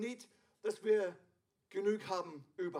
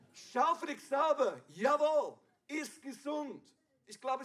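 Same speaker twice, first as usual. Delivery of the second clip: ordinary talk, nothing marked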